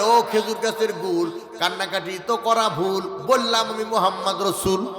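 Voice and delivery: ordinary talk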